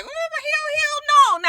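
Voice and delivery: high-pitched retold disbelief; mock indignant denial. Woman denying the affair to her accuser, mock indignant. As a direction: squeal it as a mock protest. Acted shocked denial in retelling.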